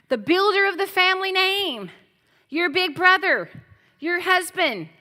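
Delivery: loud, high, ringing sermon delivery; fervent pulpit preaching; full-force preaching. Acted. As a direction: preach it out to the congregation.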